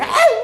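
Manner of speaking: sudden high yelp of a mourner; mock wail. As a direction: yelp it like fresh grief. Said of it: "Kalau yang masih baru datang". (jeritan ratapan tinggi yang dibuat-buat)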